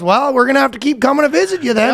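high sing-song mock Canadian; hammed-up polite Canadian warning; Canadian accent. Officers warn guy about Facebook posts, Canadian accent. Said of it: "the most Canadian interaction".

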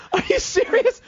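high, laughing "are you serious"; laughing disbelief. Retold or imitated. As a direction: laugh it out in shock.

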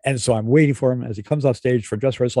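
usual speaking voice

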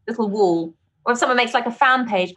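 ordinary talk, nothing marked

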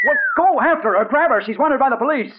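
high, loud frantic order; urgent shout. Acted. Reporter shouts at the window washer to chase the girl. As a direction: yell it at him to go now.

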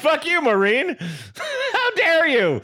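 high-pitched, laughing mock indignation; mock outrage. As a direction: play offended at the listener, in fun. Mock outrage at listener letter.

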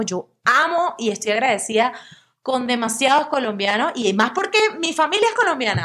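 usual speaking voice

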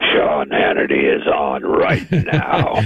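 deep morning voice. Shown as a demonstration. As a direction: drop into early-morning bass. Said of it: "I still have that morning voice".

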